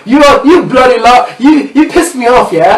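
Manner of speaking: a friend mocking an angry English guy; mock British. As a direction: play an irritated Brit, hammed up.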